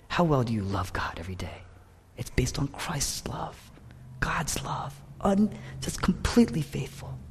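hushed, unvoiced questioning; soft intimate whisper. Real, not acted. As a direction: lean in and whisper it. Intimate preaching moment.

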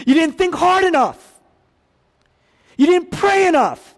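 high, loud rebuke; scolding accusation. Acted. Imagined Jesus faulting a worshipper's effort, loudly scolding. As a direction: shout it as a harsh reproach.

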